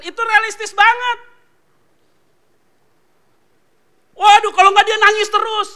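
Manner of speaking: loud, high, driving sermon voice; preaching at full force. Acted. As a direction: hammer it home to the congregation. (khotbah berapi-api dengan suara keras)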